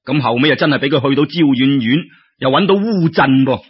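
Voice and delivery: ordinary delivery, nothing marked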